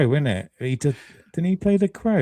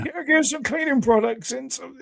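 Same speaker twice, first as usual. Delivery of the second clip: much higher, lightly put-on character quote; quoting character voice; 12 semitones higher than usual. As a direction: pitch it up like the guy in the film.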